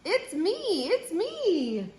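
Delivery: high singsong voice; cow character. A narrator's reading. Cow revealing who is under the mask, high singsong. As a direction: lift it high and stretch each "me". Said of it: "says the cow".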